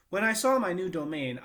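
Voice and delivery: usual speaking voice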